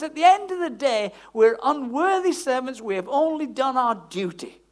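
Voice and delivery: loud, forceful scripture quotation; emphatic preaching. Real, not acted. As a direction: proclaim it firmly to the congregation.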